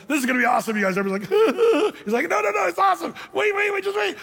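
high, shouting, giddy mock Jesus; mock glee at the tomb; hammed-up excitement. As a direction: gush like he can't wait to show the trick. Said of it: "he should have walked up".

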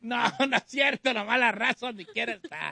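talking through leftover laughter; amused, laugh-laced speech. Real, not acted. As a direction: take it back while still chuckling. Host still laughing at message.

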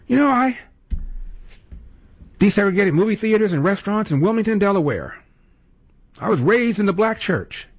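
high, sing-song mock of a boastful politician; mocking Biden quote. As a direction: parody him bragging, pitched well up. Mocking Biden's self-praise.

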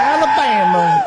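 high, slow, shouted Southern Alabama; mock Southern drawl. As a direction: stretch out Alabama like a preacher. Host mocks the clip's accent.

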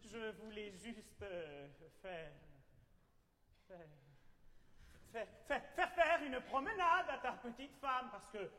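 soft, low, stammering excuse; nervous stammer. Acted.

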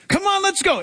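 high, eager push to go; rally the doubters; urging Peter. Retold or imitated. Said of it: "Peter urging the others".

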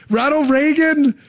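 high, slow incredulous question; mock surprise. Real, not acted. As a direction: act stunned by the name.

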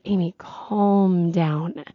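slow, drawn-out mock soothing; imitated calming down. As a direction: stretch it out like settling an over-excited colleague. Imitates news team soothing her.

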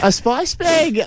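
high, gushing burst of delight; excited. Real, not acted. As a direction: announce it like a treasure. Gushing about favourite food.

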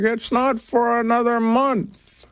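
high, slow mock-mom voice; imitating mom. Host retelling mom's Thanksgiving reply, imitating her. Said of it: "And she's like".

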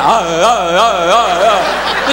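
wavering repeated ah sounds; hammed-up droning kid's howl; imitated child's wail. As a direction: wail it out in mock crying. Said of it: "Then just like".

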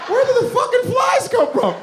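very high, bewildered yell; exasperated outburst. Acted. Comic interrupts the interview over flies, high exasperated cry.

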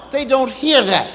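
ordinary talk, nothing marked

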